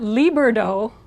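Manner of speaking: "liberdo" with a full English r in an added middle syllable. Shown as a demonstration. Teacher shows the mistake with English R, exaggerated. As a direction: say "liberdo" as lee-burr-doe, r curled back. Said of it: "there's no English R in it".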